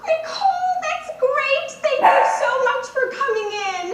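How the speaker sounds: high-pitched, gushing casting director; effusive fake thanks from a director. Casting director dismisses the auditioner.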